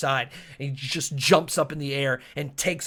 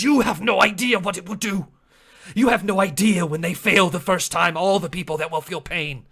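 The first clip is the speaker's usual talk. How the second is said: loud, higher-pitched indignant shout; angry outburst; 4 semitones higher, 7 dB louder than usual. Acted. Chotuku rebukes Asteri over the coming pain, angry and loud. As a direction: hurl the accusation, easing slightly at the end.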